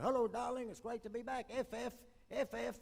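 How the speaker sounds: officer calling down to his wife on the wharf; officer calling back. Retold or imitated.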